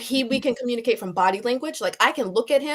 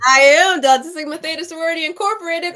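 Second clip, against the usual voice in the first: proud announcement; 7 semitones higher, 8 dB louder than usual. Real, not acted. Proudly announcing her sorority.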